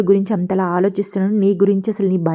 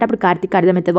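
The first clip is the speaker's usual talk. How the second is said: usual speaking voice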